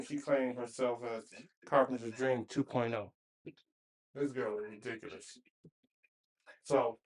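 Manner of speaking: talking around screws held in lips; screws in mouth. Real, not acted. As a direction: speak with screws in your mouth. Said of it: "my bad, I got these screws in my mouth".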